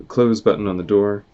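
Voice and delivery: usual speaking voice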